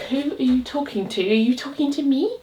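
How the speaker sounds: lightly voiced puzzled pet, high and soft; playful high, quiet voice for a bewildered pet; confused dog. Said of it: "She's so confused. She's like".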